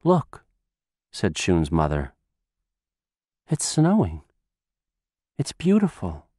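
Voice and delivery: gentle mother waking in hospital. Acted. Mother sees the first snow.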